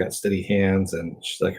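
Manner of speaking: ordinary talk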